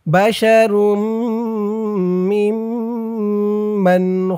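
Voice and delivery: measured tajweed chant with held hums; Quran recitation. Shown as a demonstration. Teacher reciting the phrase for learners, measured chant. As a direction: chant evenly, lengthening the nasal sounds.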